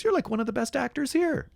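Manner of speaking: very high-pitched gushing; imitated admiring girl. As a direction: gush in a high mock girl's voice. Retold girl's gushing compliment.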